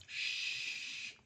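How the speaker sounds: unvoiced conspiratorial shush; mock hush. Host hushing listeners about the oil and gas, a soft shush. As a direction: hush the audience as if sharing a secret.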